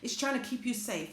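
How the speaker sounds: ordinary talk, nothing marked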